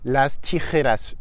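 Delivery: tijeras with a throaty j and tapped r; Spanish tijeras. Shown as a demonstration. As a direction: rasp the j in tijeras. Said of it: "une horreur".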